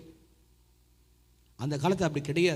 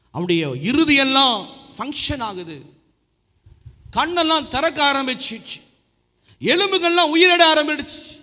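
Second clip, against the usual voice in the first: building excitement; 7 semitones higher, 8 dB louder than usual. Real, not acted. Builds Lazarus rising climax.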